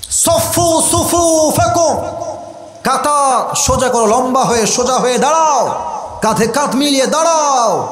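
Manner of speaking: high, ringing call to straighten the rows; imam's command. Acted. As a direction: order the congregation into line, pitched well up. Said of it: "ওমরে ফারুক এসে বলছেন". (ইমামের উঁচু গলায় কাতার সোজা করার হাঁক)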